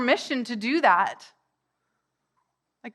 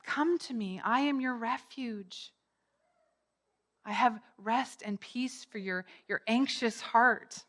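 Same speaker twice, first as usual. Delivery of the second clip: gentle, comforting; 6 dB quieter than usual. Acted. The Lord offering refuge to the anxious, gentle comfort. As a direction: gently invite the anxious to rest.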